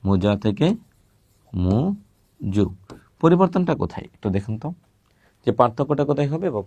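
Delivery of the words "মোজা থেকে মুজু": Muizzu stretched very slowly; drawn-out demo. Shown as a demonstration. Teacher stretches the changed word for students, very slowly. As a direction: draw Muizzu out slowly, syllable by syllable.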